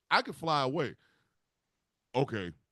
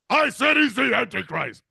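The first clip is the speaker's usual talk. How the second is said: louder, higher insistent boom; booming repeat; 5 semitones higher, 8 dB louder than usual. Pretend. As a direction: hammer the word home. Divine voice repeats, annoyed.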